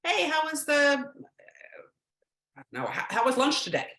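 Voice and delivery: high, bright-toned casual ask; cheery parent question. Retold or imitated. Parent to teen after school, high cheery ask. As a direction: toss it out lightly like nothing's at stake. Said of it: "So if you say".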